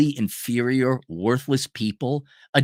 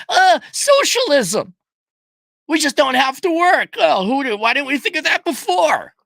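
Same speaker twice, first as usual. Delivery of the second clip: mocking dim socialist; 12 semitones higher, 3 dB louder than usual. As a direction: play a dopey idealist, much higher.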